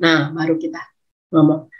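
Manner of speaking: ordinary talk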